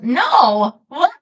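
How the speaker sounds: loud startled protest of no; surprised no. Real, not acted. To chat asking about Darth, a loud startled no. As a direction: blurt it out, caught off guard.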